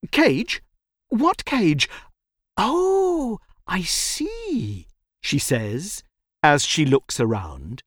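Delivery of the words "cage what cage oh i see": put-on high-pitched, questioning child; puzzled little girl. A narrator's reading. Little girl looks around for the cage, puzzled. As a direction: wonder aloud in a high girl's voice.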